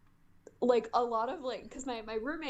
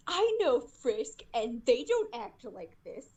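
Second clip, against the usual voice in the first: slow, louder, snooty fan voice; mock indignant commenter; 2 semitones higher, 3 dB louder, slower than usual. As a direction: mimic a know-it-all commenter. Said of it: "comments that were like".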